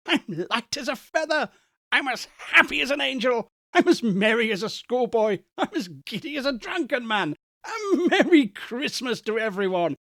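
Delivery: high, breathless delight; giddy joy. Acted. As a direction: bubble over like a giddy schoolboy.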